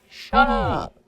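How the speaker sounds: a sharp, hammed-up shut up; mock snappy retort. Teasing a copycat, a mock snappy shut up. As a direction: toss it off like a bratty sibling.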